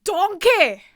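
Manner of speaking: high, loud Donkey shout. Acted. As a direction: yell it like Donkey. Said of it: "Is that what he sounds like?".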